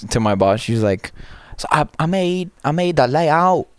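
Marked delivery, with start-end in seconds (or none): 1.6-3.7 thick mock Chinese accent, pitched high. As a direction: imitate a coworker's Chinese accent in a raised voice. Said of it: "Chinese accent".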